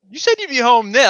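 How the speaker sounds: husband retelling his wife's scolding in a mock voice. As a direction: lightly imitate the wife's scolding. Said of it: "my wife's like".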